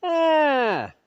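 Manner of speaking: high sharp cry; imitated child's cry. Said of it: "exactly the same sound".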